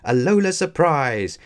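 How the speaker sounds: big cheerful surprise call; excited cry. A narrator's reading. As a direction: cry it out like a welcoming crowd. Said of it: "cried his classmates".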